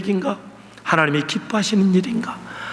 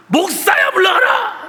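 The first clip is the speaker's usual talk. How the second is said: much higher shouted retort; congregation talking back; 12 semitones higher, slower than usual. Retold or imitated. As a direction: shout it back as the offended crowd, mock indignant. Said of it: "여러분이 내게 뭐라 그랬어요".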